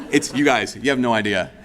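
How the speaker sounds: breaking into laughter mid-sentence; laughing at himself. Real, not acted. Preacher laughing at his own marathon slip. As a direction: laugh through the words at your own mistake.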